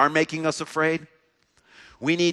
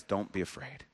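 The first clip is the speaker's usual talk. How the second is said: soft, lower, near-whispered reassurance; God's gentle hush, much softer; tender comfort; 6 semitones lower, 14 dB quieter than usual. Acted. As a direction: lean in and soothe a frightened child. God comforting the afraid listener.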